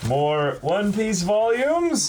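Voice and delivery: high, slow, amazed rising question; stretched-out excited surprise; stunned delight. Real, not acted. Surprised unboxing of gift volumes.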